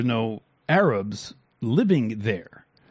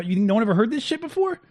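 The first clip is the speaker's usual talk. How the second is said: high, quick smug imitation; mock smug; 9 semitones higher, faster than usual. Streamer mimics pundits saying these things, mock smug. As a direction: imitate a smug know-it-all, pitched up. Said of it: "they're all smug too".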